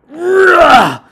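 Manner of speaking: high loud shout on one word; sudden shout; shouted but. A narrator's reading. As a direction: yell the turn of the story. Shouted dramatic turn.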